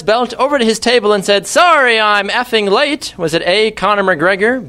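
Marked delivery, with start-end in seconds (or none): 1.5-3.1 acted quote. Acted quote within quiz question.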